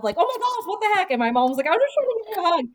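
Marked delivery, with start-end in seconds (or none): 0.2-1.1 high-pitched retold outcry; mock yell. Sister reacting to mom's first hug, mock yell. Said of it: "yelled out loud". 1.7-2.6 high-pitched, defensive protest; protesting mom. Retold or imitated. Mom explaining her hug to startled daughter, high protest. Mom's defence in retold quarrel.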